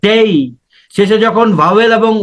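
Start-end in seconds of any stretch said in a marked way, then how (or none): 0.1-0.3 ডেই with a gliding ei at the end; ডেই said as a clear diphthong; diphthong dei. Shown as a demonstration. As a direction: glide the vowel into ই in ডেই. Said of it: "উচ্চারণ হচ্ছে".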